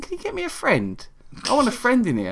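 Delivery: high, soft voice of a talking head; Gemma Arterton. Acted. Severed head asking Jerry for a friend, high and soft. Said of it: "his head's talking to him".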